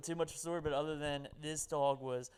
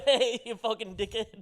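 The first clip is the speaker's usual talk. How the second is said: mock Boston; 6 semitones higher, 8 dB louder, slower than usual. Joins the Boston bit.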